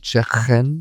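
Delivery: "chicken" with a throaty Scouse K; Scouse accent. Shown as a demonstration. Teacher showing learners the K sound, Scouse accent. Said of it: "sounding like they begin in the throat".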